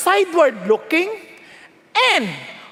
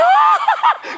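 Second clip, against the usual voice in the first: squealing laugh; 4 dB louder than usual. Retold or imitated. Women swooning at preacher.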